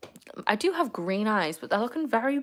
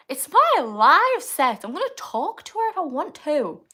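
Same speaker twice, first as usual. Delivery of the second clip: mock defiance; 6 semitones higher, 10 dB louder than usual. Mock-defiant reply to chat arguing.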